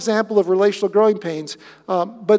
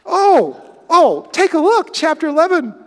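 much higher, louder eager "oh"; bursting to show the next chapter; excited; 7 semitones higher, 5 dB louder than usual. Real, not acted. Preacher urging the congregation to turn the page, excited.

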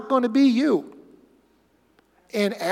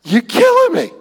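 much higher, louder cry of disbelief; exasperated; 13 semitones higher, 6 dB louder than usual. Real, not acted. Preacher exasperated at the critics, a high incredulous cry. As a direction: throw up your hands at the absurdity.

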